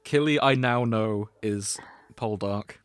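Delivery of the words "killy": ordinary talk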